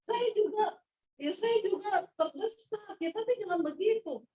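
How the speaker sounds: high, loud and agitated; worked-up self-defence; flustered protest. Retold or imitated. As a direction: push back at your husband, rattled. Speaker defends herself to husband.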